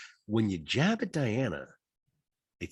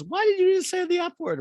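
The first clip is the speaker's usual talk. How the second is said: put-on child voice; 5 dB louder than usual. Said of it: "the son goes".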